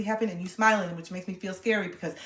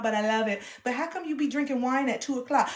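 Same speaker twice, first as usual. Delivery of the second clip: normal talking voice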